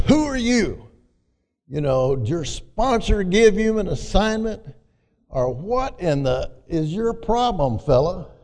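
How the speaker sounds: a mild mock confrontation; a hint of a gruff bully. Bully Hess challenges newcomer.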